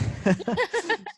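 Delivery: short, high burst of laughter; quick high giggle, real; amused laugh. Real, not acted. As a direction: crack up at your own quip. Guest amused by own quip.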